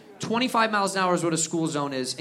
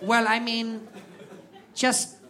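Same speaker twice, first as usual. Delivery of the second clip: gruff German rep; slower than usual. Retold or imitated. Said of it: "And he was like".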